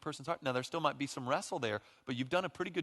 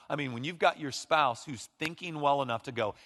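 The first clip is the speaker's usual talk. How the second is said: ordinary talk, nothing marked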